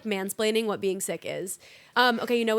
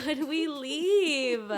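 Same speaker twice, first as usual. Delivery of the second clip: slow drawn-out show title; 4 semitones higher, much slower than usual. Acted. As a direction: draw out the podcast name. Podcast title said slowly.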